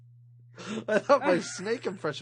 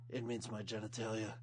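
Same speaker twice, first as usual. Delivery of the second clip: low, soft tough-guy voice; Snake impression; 8 semitones lower, 11 dB quieter than usual. Tough prisoner asking tattoo artist for snake, low and soft. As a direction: drop the voice low and quiet like a hard man. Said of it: "Amazing snake imitation".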